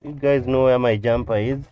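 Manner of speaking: loud call to people nearby; yelling across the room. Real, not acted. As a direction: shout the question across the room. Calls to others off camera.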